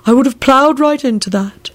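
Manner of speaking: high-pitched accusing complaint. A narrator's reading. Servant who nearly hit the skeletons, accusingly. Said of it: "he said accusingly".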